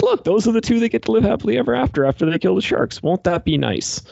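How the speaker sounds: high, quick sing-song sarcasm; mock sweet prediction. Predicting the happy couple will survive, high sing-song mock sweetness. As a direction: chirp it like a smug prophecy.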